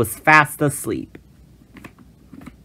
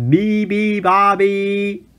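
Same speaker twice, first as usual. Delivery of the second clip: thundering; 7 semitones higher, much slower than usual. A narrator's reading. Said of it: "thundered the moose".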